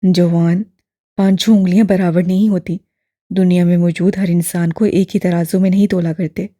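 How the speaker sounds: lightly voiced old man, fond; affectionate old man. A narrator's reading. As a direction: counsel him with affection. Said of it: "बड़ी मोहब्बत से".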